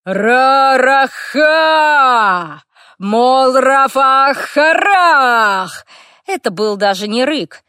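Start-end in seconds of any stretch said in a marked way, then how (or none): 0.1-5.9 very slow, loud, high battle chant; orc war cry. A narrator's reading. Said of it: "боевой клич".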